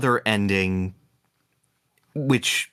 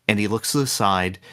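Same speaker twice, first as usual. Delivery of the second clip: ordinary delivery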